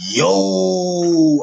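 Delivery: high, loud shocked yo. Retold or imitated. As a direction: react like it stinks. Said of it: "I'm like".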